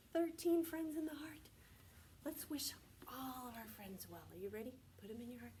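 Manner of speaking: breathy unvoiced whisper; secret-sharing whisper to children. Shown as a demonstration. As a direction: lean in and whisper it to the class. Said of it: "teacher whispering to class".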